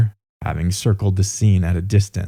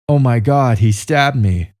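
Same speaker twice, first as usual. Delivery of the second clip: restrained cry, slightly higher and louder; narrated cry of alarm; 4 semitones higher, 4 dB louder than usual. A narrator's reading. Stabbed woman crying out to the street, mildly raised narrator's cry. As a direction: read a victim's outcry with mild urgency. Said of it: "Kitty Genovese cried".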